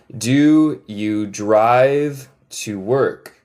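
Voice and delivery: very slow, every word stretched; slow model question. Shown as a demonstration.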